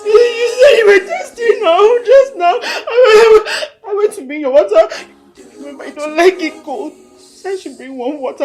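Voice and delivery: loud, tearful begging that surges into cries; sobbing wail. Acted. Child begging dead mother to stand and drink, wailing. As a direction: beg and wail through heavy sobs.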